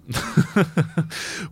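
airy silent laugh; breathy laugh. Real, not acted. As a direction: laugh softly under your breath. Joke about Italians. (тихий беззвучный смешок)

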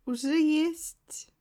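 slow, teasing and secretive; mysterious voice. Acted. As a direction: drag it out mysteriously. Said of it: "Загадочным голосом спросила та".

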